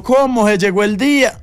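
excited surprise. Retold or imitated. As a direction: react like the best news ever. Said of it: "Y yo".

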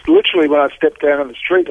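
normal talking voice

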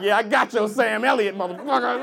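loud, hammed-up aggression; mock threat. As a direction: bark it back as a comic comeback. Acts audience member fighting back.

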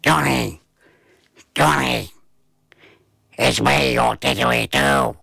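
gruff mystery voice calling a name. A narrator's reading. Hidden didgeridoo calling to the boy, gruff. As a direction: call out in a rough, gruff growl. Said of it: "this gruff voice".

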